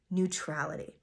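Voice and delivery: usual speaking voice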